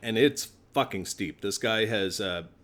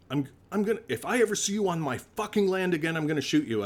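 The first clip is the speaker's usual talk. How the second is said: mock furious threat; 7 semitones higher, faster than usual. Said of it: "he was seeing red".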